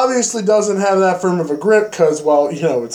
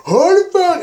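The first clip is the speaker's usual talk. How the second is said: mock alarmed yell; 13 semitones higher, 3 dB louder than usual. Group realises danger, flees.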